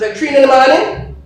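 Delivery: loud, high-pitched disbelief; mother's incredulous question. Retold or imitated. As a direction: challenge the excuse, voice shooting up. Mother questions son's excuse.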